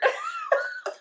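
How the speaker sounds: high burst of laughter. Real, not acted. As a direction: crack up laughing. Laughing at candy flavors.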